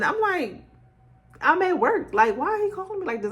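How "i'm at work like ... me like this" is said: puzzled reply. Retold or imitated.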